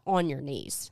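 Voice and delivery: low, firm mock order; barked command. Retelling how the man orders Charlie down, barked command. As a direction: order it low, at an ordinary volume. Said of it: "he barks out".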